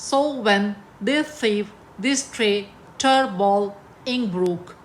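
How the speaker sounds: slow line with a pause after "when", "tray", "ball"; read foot by foot. Shown as a demonstration. Teacher rereading the pentameter line, "so when" onward in slow, separated two-beat feet. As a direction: scan it in pairs: "so when", "turf ball", "ink brook". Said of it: "अभी मैं इसको दोबारा पढ़ूंगी".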